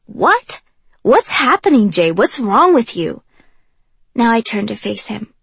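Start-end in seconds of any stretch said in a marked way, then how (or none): 0.1-3.2 high, loud startled what, then slower; alarmed surprise. Acted. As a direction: shaken and pressing for answers. Kenji alarmed by her coldness.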